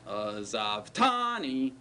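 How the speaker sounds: TANI stretched out after azav; stress on tani. Shown as a demonstration. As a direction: hold the ending "tani" long and clear. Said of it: "do you hear the tani ending".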